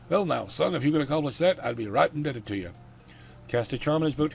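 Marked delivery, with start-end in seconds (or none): 0.1-2.7 put-on loud, raised country gentleman's accent; hammy, courtly country Dracula accent. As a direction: accept gratefully in a big Dracula accent. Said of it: "I'm sticking with the accent I gave him".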